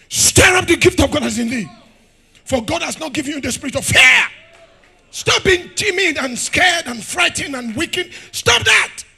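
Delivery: loud, high, shouting preacher; fiery preaching. Acted. Preacher rouses church about dominion, shouting loud and high. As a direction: hammer each line at the congregation.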